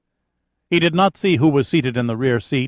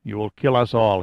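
ordinary delivery, nothing marked

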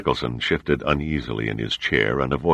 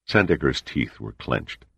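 usual speaking voice

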